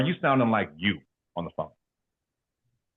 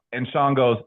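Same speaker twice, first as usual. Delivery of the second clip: normal talking voice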